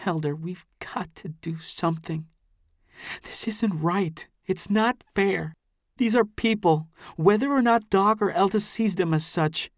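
put-on a distressed girl growing insistent; upset young woman. A narrator's reading. Amy pleads against the drug.

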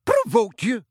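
high shouted echo; incredulous shout. A narrator's reading. Professor rejecting a pupil's excuse, incredulous shout. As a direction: throw it back in high-pitched outrage. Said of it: "shouted Professor McGonagall".

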